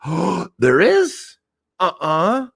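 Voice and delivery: big gasp, then high, drawn-out innocence; feigned surprise. Acted. Driver denies eating slices to boss, high mock surprise. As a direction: act shocked, badly faking innocence.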